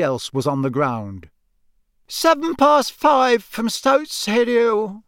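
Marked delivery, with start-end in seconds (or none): 2.1-5.0 high, slow, stretched-out call; announcer's call. Acted. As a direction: announce an arrival, drawing out each word. Official calls out portkey arrival.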